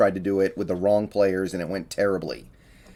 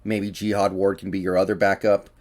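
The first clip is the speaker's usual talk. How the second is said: ordinary talk, nothing marked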